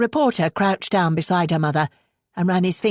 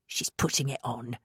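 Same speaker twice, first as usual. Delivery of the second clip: softer, scornful dismissal; disgusted; 4 semitones lower, 10 dB quieter than usual. A narrator's reading. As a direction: sneer it with disgust. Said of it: "said Bex in disgust".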